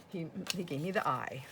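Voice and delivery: usual speaking voice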